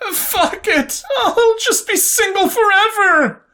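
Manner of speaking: high, crying half-yell; a tearful outburst into her arms; half-yelled despair. A narrator's reading. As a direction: wail it into your arms, half laughing, half crying. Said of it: "she half yelled into her arms".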